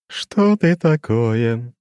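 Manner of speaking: high, slow, ringing demon voice; eerie chiming demon, slow and lofty; crystal ringing voice. A narrator's reading. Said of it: "да ещё таким голосом". (высокий звенящий хрустальный голос демона)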